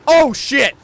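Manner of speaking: sudden scared yelp; startled. Real, not acted. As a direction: jump with fright. Wolf appears suddenly.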